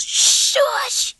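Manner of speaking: sharp hushed shush; whisper. Acted. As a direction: hiss it to silence her. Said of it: "he whispered".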